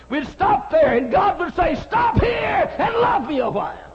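high, loud pulpit cry; preaching shout. Acted. Preacher crying out God's call to the congregation, loud. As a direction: lift the voice to a preaching peak.

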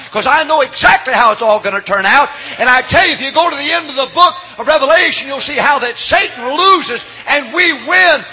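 loud, high-pitched revival sermon; impassioned pulpit shouting; full-force preaching. Acted. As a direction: drive it home to rouse the congregation.

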